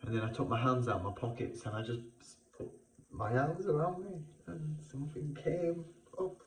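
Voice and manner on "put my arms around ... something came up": ordinary talk, nothing marked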